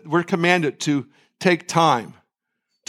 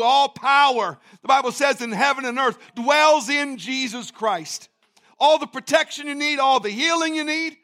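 full preaching; 10 semitones higher than usual. Acted.

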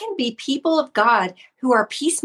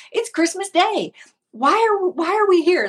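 much higher, laughing mock-whine of a bored child; mock complaint from a bored kid on Christmas Day, much higher; whiny kid daughter; 9 semitones higher than usual. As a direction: play the daughter whining, higher and half-laughing.

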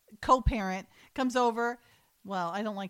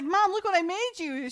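put-on high, eager little boy; proud kid; 8 semitones higher, 4 dB louder than usual. Little boy showing mom his gift, high and eager. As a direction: show it off like an excited kid.

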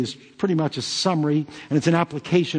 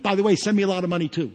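quick mock aside; 3 semitones higher, much faster than usual. Mock healer's quick money aside.

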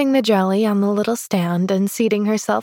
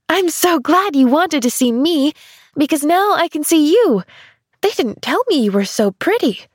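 put-on higher, bright-eyed young girl; little girl voice; 7 semitones higher, 3 dB louder than usual. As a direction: play an eager little girl. Child's voice, eager.

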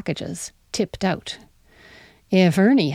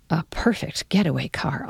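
whispered thought; 2 semitones lower than usual. A narrator's reading. Narrator confiding a suspicion to herself, whispered. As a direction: breathe the thought as a private whisper.

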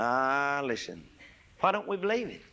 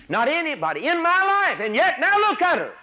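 much higher, louder, ringing out; preaching cry; 8 dB louder than usual. Real, not acted. Preacher lamenting the lost lady, full-force cry.

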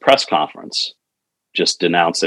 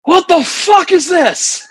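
high, slow yelled outrage; shouted disbelief; 3 dB louder, slower than usual. Retold or imitated. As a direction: bellow it in disbelief. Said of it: "all we hear is LaCivita".

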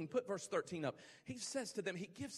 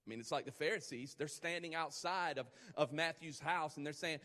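ordinary talk

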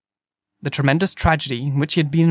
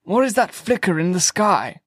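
higher-pitched sudden query; abrupt question; 5 semitones higher than usual. A narrator's reading. Curate notices a light above them, abrupt. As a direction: break off and ask abruptly. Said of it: "he asked abruptly".

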